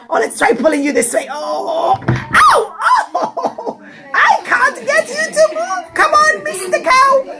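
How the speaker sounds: high strained yelps broken by laughs; straining and laughing. Acted. Rich man struggles with his heavy cow, high strained yelps and laughter. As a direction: heave at a sitting cow, laughing through the ows.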